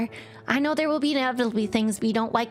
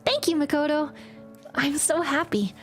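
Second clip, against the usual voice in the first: choking up; 6 semitones higher than usual. Acted. Aika thanking Makoto, choking up. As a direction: thank her while fighting tears. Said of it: "starts to choke up again".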